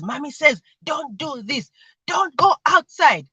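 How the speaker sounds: high, emphatic mimicked scolding; child parroting mom's high-pitched warning; slow, loud, high last command, playacted; imitating panicked mom. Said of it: "the gate is high".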